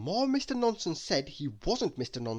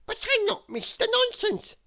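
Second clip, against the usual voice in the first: protesting; 4 dB louder than usual. Acted. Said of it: "protested Mister Nonsense".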